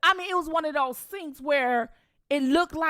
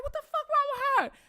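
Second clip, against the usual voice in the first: high fast mock family voice; family staring at her; family confused; 13 semitones higher, faster than usual. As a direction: imitate the family's baffled reaction. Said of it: "everybody was like".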